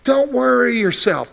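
ordinary talk

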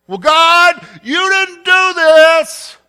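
loud, high whining complaint to God; complaining Elijah. Retold or imitated. Prophet whining to God that he stands alone. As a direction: wail it like a worn-out prophet. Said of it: "That's what Elijah says".

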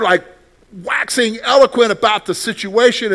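ordinary delivery

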